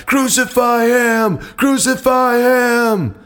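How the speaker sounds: slow, high mob shout; crowd shouting. A narrator's reading. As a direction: roar it like an angry mob. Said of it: "began shouting".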